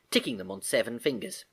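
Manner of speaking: ordinary delivery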